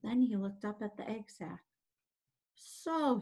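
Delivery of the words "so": higher, mildly scornful word; understated disgust, pitched up. Acted. Templeton the rat asked to climb up, disgusted. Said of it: "he said in disgust".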